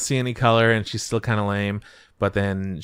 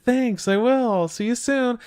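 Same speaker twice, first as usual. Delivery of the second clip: pitched way up, chirpy reply; high girly voice; 13 semitones higher than usual. Retold or imitated. Heroine answering her bandmate, high girly voice. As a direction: squeak it back brightly up high. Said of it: "She's like".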